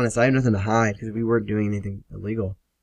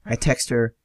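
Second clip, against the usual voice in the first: ordinary delivery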